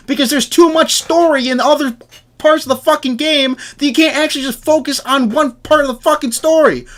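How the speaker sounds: rising mock rant; mock frustrated rant. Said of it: "And you're like".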